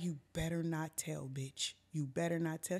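low, soft threat; hushed warning. Retold or imitated. Storyteller to friend asked who smoked, hushed warning. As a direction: warn her quietly so nobody else hears.

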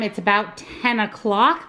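ordinary delivery

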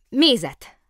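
sudden loud, high burst; blurted order. A narrator's reading. Bear finally orders from the waiter, blurting. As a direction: blurt it out at last.

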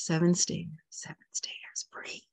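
fading whispered count. Acted. Guide counting the listener down the stairs, whispering. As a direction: let the count dissolve into a whisper.

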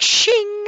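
high, loud ringing "shing"; sword-drawing noise. Retold or imitated. Player draws his new sword, high ringing imitation. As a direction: ring out like a drawn blade.

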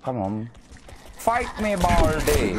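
high loud taunt; taunting yell. Real, not acted. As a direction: yell a challenge. Taunting enemy in fight.

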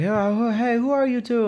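high, friendly put-on voice of the Turkish guy; imitating the ice cream man. Vlogger imitates the Turkish ice cream man greeting them, high and cheerful. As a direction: lightly mimic a cheerful vendor greeting. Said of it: "he was like".

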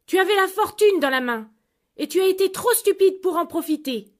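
loud, high angry scolding; wife berates the fisherman; indignant scolding. Acted. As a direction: tear into him for his stupidity. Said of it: "La femme était très indignée".

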